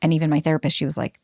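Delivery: ordinary talk, nothing marked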